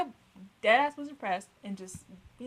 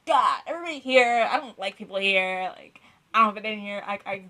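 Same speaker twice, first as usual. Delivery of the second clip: louder frustrated inner rant; exasperated rant; 13 dB louder than usual. Retold or imitated. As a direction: vent about not fitting in. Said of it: "I was like".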